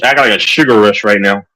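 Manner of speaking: normal talking voice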